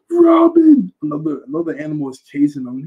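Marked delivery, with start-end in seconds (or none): 0.1-0.9 very high, loud call of "Robin"; swooning Sanji. Retold or imitated. Sanji calling out to Robin, very high and loud. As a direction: sing out her name, lovestruck. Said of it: "it just goes".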